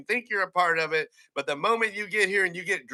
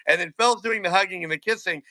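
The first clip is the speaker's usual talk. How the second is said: ordinary talk, nothing marked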